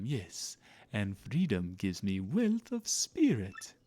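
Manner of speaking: theatrical, swooping foreign-sounding merchant; self-important merchant musing grandly; pompous rich trader. Acted. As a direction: play a grandiose trader with leaping pitch.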